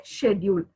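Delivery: "schedule" as shed-yool; British pronunciation. Shown as a demonstration. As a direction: say "schedule" with an sh start. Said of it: "We follow British".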